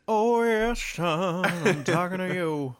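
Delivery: hammed-up diva boom. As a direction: belt it out like a drag queen mother. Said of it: "Ru was going".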